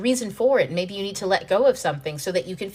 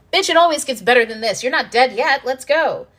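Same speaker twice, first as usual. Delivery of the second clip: louder, higher sassy pep talk; sassy hype; 5 semitones higher, 6 dB louder than usual. Pretend. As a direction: hype her up like a bossy best friend. Universe voiced, sassy.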